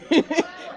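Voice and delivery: short, high giggle; amused giggle. Real, not acted. Speaker amused before starting the talk, light giggle. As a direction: let a quick giggle slip out.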